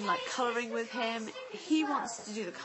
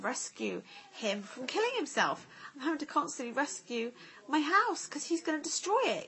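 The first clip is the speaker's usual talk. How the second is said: ordinary talk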